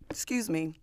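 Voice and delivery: apologizing through a burp. Real, not acted. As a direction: excuse me after a burp. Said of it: "all of that burping".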